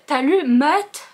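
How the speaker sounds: [name] with an open southern vowel, like mat; imitating a friend's southern drawl on [name]; southern French accent. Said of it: "avec son accent du sud". (accent du sud sur « [name] »)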